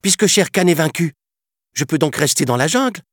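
high, loud, fast hopeful question; eager boy. Acted. Mowgli asking to stay in the jungle, high excited burst. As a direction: burst out with joy. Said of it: "s'écria Mowgli".

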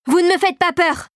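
high, defiant cry; defiant yell. A narrator's reading. Clara faces down the laughing puppet, defiant high yell. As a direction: hurl it back, pitched high. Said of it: "hurla celle-ci".

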